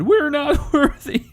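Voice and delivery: very high, slow, laughing squeak; squeaky mock voice. Gamer mocking a weak general in a laughing squeak. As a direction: squeak it out while cracking up.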